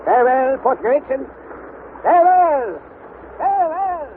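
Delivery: rising calls of farewell, the last ones high; calling farewell. Acted. Uncle calling farewell to the shore as the raft leaves, rising calls.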